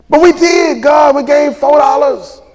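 high, whiny protest; mock protesting giver. Preacher mimics congregation pleading with God, high whine. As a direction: plead like a caught church member.

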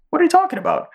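very high cartoon mouse; squeaky high puzzled Speedy. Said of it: "He goes".

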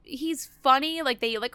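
usual speaking voice

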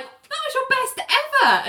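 high-pitched mock gushing; gushing praise. [name] praising John's dismount, high mock gushing. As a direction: squeal the praise in a high put-on voice.

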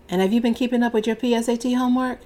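quick, breezy check-in about "homework"; 随口快速问一句"homework"; casual question. Acted. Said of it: "Now I'ma ask you again".

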